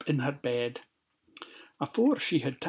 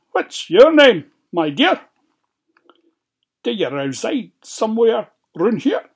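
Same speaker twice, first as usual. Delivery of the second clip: high, loud storybook wolf; inquisitive wolf; 8 semitones higher, 11 dB louder than usual. A narrator's reading. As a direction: question the child in a big, high wolf voice. Wolf questions the girl in story.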